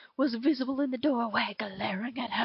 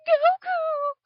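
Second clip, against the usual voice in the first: high, loud, tearful call of his name; joyful cry; 12 semitones higher, 9 dB louder than usual. A narrator's reading. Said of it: "cried Anne".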